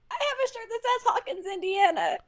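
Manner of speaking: high, bright-eyed brag about the shirt; excited. Real, not acted. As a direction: proudly announce it, way up high.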